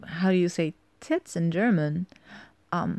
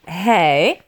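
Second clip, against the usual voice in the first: loud sharp hey; sharp warning; 11 dB louder than usual. Acted. To her partner, stopping his hands: loud sharp Hey. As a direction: snap him back to wait.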